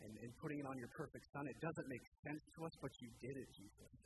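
soft, hushed prayer voice; hushed, earnest prayer. Real, not acted. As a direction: pray quietly and reverently. Leads the congregation in prayer.

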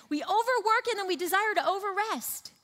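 high, loud punch on overwork; emphatic. Real, not acted. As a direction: preach it out hard and loud. Preacher punching the excess point.

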